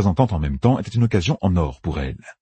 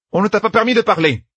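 high, loud, sharp command; sharp rebuke; 4 dB louder than usual. Acted. Yukiri silencing Egwene, sharp and loud. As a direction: shut her up.

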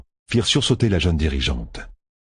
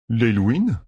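surprised and suspicious; 5 semitones higher than usual. Acted. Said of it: "à la fois surpris et suspicieux".

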